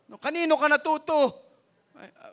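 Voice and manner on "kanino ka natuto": high, loud put-on parent voice; mock scolding parent. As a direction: play a parent demanding where the kid learned it. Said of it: "At huwag mong sasabihin".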